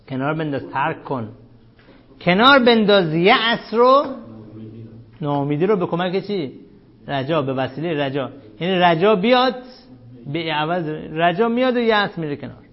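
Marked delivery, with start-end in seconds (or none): none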